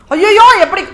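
loud, high cry of dismay; dramatised shocked protest; aghast. Acted. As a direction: recoil in acted horror. Arjuna aghast at order to kill.

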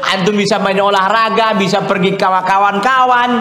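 ordinary delivery

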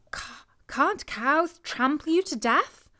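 high, stammering scared question; frightened stammer. A narrator's reading. Woman fears the cow in the field, high and stammering. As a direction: stumble over the words in fright.

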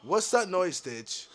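low, soft wary question; hushed question. Real, not acted. Player asking Ditch about a noise, hushed. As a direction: ask it quietly, keeping the voice down.

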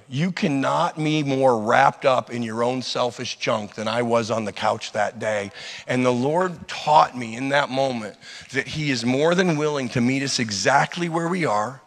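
ordinary talk, nothing marked

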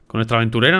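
normal talking voice

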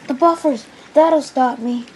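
high, loud exclamation; acted urgent relief; sudden hope. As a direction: cry out on spotting rescue ahead. Percy spots the buffers ahead.

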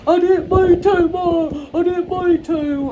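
high, loud whining wail; hammed-up tearful plea to mom; mock crying. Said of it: "he's gonna be crying like".

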